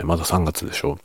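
normal talking voice

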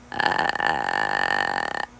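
quiet vocal fry with little air; barely voiced crackling ah; soft edge voice. Shown as a demonstration. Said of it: "ほんの少しの声でもできる". (小さな声のエッジボイス)

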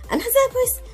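normal talking voice

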